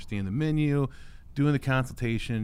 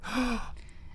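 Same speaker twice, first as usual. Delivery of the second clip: high surprised gasp; delighted gasp; 13 semitones higher, 6 dB quieter than usual. Pretend. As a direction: gasp like a touched customer. Client delighted at remembered detail.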